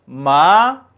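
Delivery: 마 climbing from re up to sol; second tone. Shown as a demonstration. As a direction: slide 마 steadily upward. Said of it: "이성".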